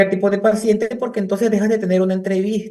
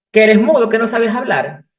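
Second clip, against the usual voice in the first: loud, high mock scolding of the son; scolding mother; 5 semitones higher, 6 dB louder than usual. Mother scolds her silent son, loud mock anger. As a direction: snap at him like the mother.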